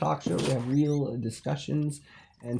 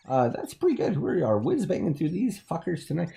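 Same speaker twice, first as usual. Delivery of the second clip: ordinary talk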